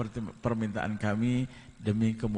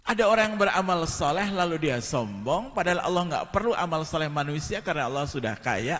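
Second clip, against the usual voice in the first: 1 forceful preaching; 7 semitones higher, 4 dB louder than usual. Acted.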